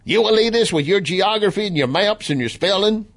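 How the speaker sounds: high, mocking Southern twang; Trump supporter impersonation. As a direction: imitate a Southern heckler sneering at elitists. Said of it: "doing a Trump supporter impersonation too".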